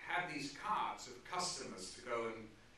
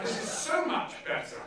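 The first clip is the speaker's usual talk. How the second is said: Lindsay's praise; 14 semitones higher, 8 dB louder than usual. Retold or imitated. Lindsay praising the rewritten script.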